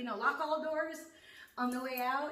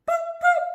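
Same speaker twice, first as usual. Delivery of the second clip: loud, much higher beeping noise; imitating remote beep; 20 dB louder than usual. Mimicking neighbors beeping her car. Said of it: "Those lovely bastards were just like".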